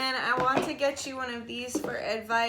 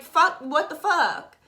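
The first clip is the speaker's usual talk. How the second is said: mock outrage; 5 semitones higher, 8 dB louder than usual. Calling out partner who misbehaved, hammed-up outraged scolding. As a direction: call them out hard, but play it up.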